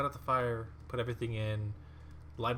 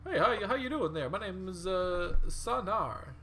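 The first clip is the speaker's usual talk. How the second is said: higher, fast, laughing mummy voice; breezy, friendly self-introduction, higher; chatty mummy; 7 semitones higher, 5 dB louder than usual. Acted. Game master voicing Sanar introducing himself to the party, chatty. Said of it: "It's like".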